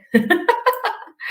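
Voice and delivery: loud, high burst of laughter; nervous laugh. Real, not acted. Presenter admitting nerves to the group, nervous laugh.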